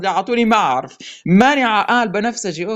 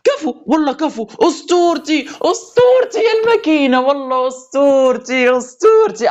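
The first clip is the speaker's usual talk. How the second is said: excited shouting; 6 semitones higher than usual. Real, not acted. Player praising the machine after a legendary win, high joyful shouting. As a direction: yell your delight at the machine. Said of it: "أنا بلشت أصرخ وأعيط".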